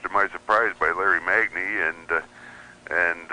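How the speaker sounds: normal talking voice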